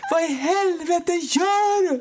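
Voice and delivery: high, slow mock yell of rage; imitating a furious yell. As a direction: shriek it like the angry husband on the phone. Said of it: "Och då bara skriker han".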